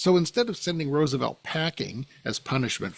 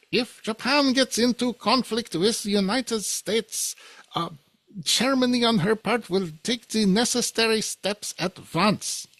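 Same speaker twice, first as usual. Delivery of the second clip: higher-pitched, hammy mock German accent; raised pitch, exaggerated German accent, self-consciously bad; Hitler in a bad German accent; 9 semitones higher than usual. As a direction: put on a deliberately bad German accent to voice a dictator's boast. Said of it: "I'm gonna use my bad German accent for this".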